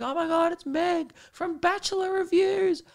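very high squealing fan voice; screaming fans. Retold or imitated. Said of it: "Everyone's like".

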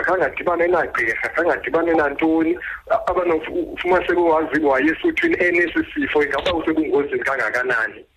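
phone call. Real, not acted. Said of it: "Vuthela emnxebeni".

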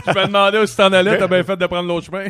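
fast, laughing tease. Real, not acted. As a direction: laugh through the joke. Teasing joke, laughing.

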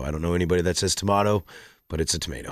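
usual speaking voice

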